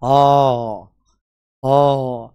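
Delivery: आ drawn out slowly and loud, as if from the belly; throaty आ demo. Shown as a demonstration. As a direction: push a long आ up from the stomach. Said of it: "जैसे". (पेट से निकलता धीमा, ज़ोरदार "आ")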